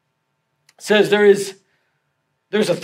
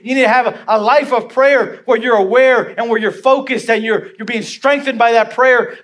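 raised, driving sermon voice; impassioned, higher-pitched exhortation; forceful preaching; 5 semitones higher, 5 dB louder than usual. Real, not acted. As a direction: press each point home to the congregation.

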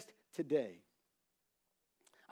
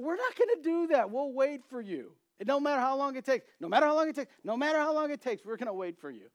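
higher mock reassurance; earnest promise, raised pitch; pleading turtles; 8 semitones higher than usual. Turtles promise the third they'll wait, mock earnest.

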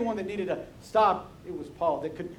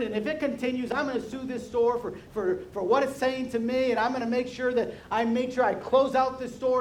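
acted indignant rant, pitched up; worked-up, higher-voiced tirade; mad customer; 4 semitones higher than usual. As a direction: rattle off an outraged complaint with threats. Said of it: "Now she's mad".

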